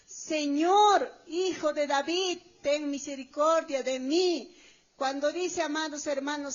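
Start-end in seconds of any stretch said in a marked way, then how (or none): 0.1-4.6 raised voice, loud on the first word; crying out. Retold or imitated. Canaanite woman calling to Jesus for mercy, crying out. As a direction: call out loudly, pleading for mercy. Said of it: "clamaba diciendo".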